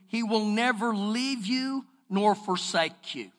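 slow, soft scripture reading. Acted. Pastor reading Deuteronomy to the church, slow and soft. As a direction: read it low and deliberate.

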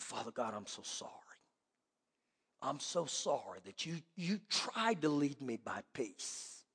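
low, hushed confession; subdued retold apology to God; remorseful prayer. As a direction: pray it very softly, weighed down with regret. Retold remorseful prayer after crash.

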